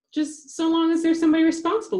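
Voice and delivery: put-on high, loud squeaky girl's voice. A narrator's reading. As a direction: squeak it out, pitched well up. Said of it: "in her squeaky voice".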